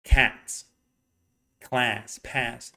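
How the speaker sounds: "cat, class, pass" with an open front A; "cat, class, pass", each with the crying-baby vowel; Californian short A. Shown as a demonstration. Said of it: "the way we do".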